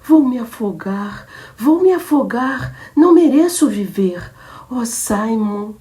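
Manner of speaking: crying despair. Acted. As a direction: weep the threat out. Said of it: "ela recomeçou a chorar". (choro desesperado e lento)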